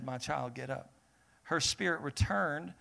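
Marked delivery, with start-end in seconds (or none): 0.0-0.9 soft, tender wake-up; Jesus softly waking the girl; gentle call. Acted. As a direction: coax the child up gently.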